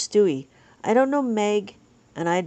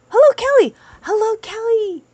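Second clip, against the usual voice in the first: high-pitched, singled-out hello; very high, real excited welcome; excited greeting; 12 semitones higher, 7 dB louder than usual. Real, not acted. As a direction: greet them in a high, delighted voice. Calling a greeting to arriving viewer.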